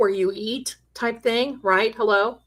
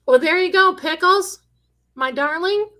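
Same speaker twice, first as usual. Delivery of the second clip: high, sing-song voice for the pet; sweet talk to a pet dog, pitch up; cooing to the pet; 8 semitones higher, 4 dB louder than usual. Real, not acted. Talking sweetly to her pet.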